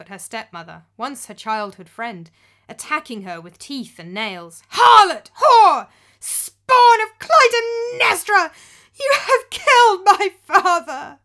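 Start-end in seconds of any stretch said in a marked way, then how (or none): 4.7-11.2 high, loud raging shriek breaking toward tears; furious screaming. Acted. Grieving daughter accusing her stepmother, screaming in fury. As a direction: hurl every insult at her full strength. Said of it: "And she broke down, weeping".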